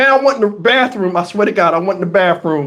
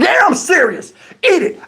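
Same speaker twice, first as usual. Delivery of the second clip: high, emphatic retort; angry insistence; 8 semitones higher than usual. Retold or imitated. Husband ordering her to eat the muffin, angrily insistent. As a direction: insist hotly, pitch jumping up. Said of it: "express my anger".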